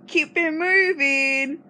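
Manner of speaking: "Keep it moving" high, slow and drawn out; hammed-up catchphrase. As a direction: drag out "Keep it moving" like a show host.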